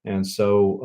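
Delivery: ordinary talk, nothing marked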